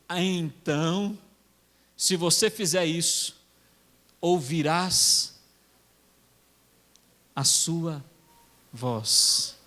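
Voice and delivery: low, drawn-out words with long pauses; slow, weighty Bible recitation; solemn scripture quote. Acted. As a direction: let each phrase of the promise hang. Solemnly quoting the Bible's promise.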